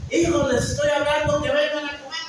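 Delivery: put-on a fed-up parent calling the kids down; annoyed parent. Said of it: "se enoja el, eh, la mamá o el papá".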